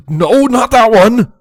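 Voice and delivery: sudden high, loud, slow cry; exclaiming. A narrator's reading. Father pony stopping the foal at the cupboard, loud high cry. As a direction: stop him fast, with alarm. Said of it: "Bo Hothoof exclaimed".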